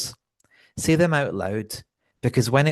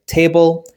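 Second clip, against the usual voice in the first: "table" with stress on TA; TA-ble, slow, second syllable left weak; stress on first syllable; 7 semitones higher, 8 dB louder than usual. Shown as a demonstration. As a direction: draw out and lean on the start of "table". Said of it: "we stress the first part".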